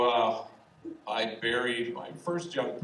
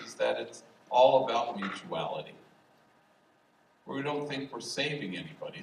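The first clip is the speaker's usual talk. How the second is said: ordinary delivery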